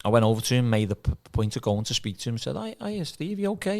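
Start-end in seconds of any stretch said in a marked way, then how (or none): 2.6-3.8 sugary greeting. Retold or imitated. Mock greeting to former manager.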